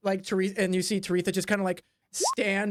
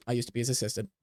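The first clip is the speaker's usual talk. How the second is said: resigned assistant; 5 dB quieter than usual. Acted. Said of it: "looks at Taretha and goes".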